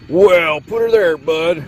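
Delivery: high, loud Texan howdy; energetic Texan accent. A narrator's reading. Texas greeting a fellow Paterson engine, loud Texan exclaim. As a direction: exclaim like an eager cowboy. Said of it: "the engine exclaimed quite energetically".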